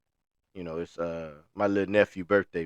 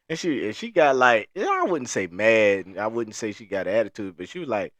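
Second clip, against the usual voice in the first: usual speaking voice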